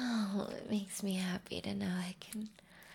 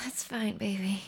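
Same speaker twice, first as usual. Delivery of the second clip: usual speaking voice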